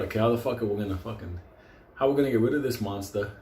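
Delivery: usual speaking voice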